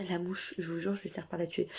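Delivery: annoyed aside at the fly, muttered. Real, not acted. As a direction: mutter it away from the mic.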